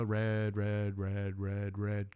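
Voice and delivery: low, soft, repetitive monotone; tense countdown. Acted. Waiting at the light beside the Turbo, tense countdown. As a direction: hold it low and steady, building suspense.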